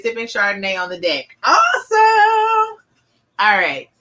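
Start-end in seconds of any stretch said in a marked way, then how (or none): 1.4-2.8 high, slow, stretched awesome; drawn-out awesome. Real, not acted. Host reacts to a viewer sipping Chardonnay, high and drawn out. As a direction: sing out the praise.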